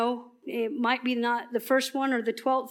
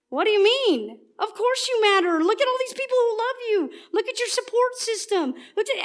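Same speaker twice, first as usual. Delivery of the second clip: much higher, pushy, judging tone; high squeaky judgmental; 10 semitones higher, 3 dB louder than usual. Acted. Preacher mocking a friend's dismissive high squeaky reply. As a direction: squeak it out like a know-it-all brushing off pain. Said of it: "your voice gets really high and squeaky".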